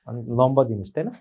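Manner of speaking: usual speaking voice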